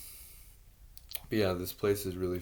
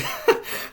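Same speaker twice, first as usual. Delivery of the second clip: bitter laugh; 8 dB louder than usual. Real, not acted. Bitter laugh mid rant.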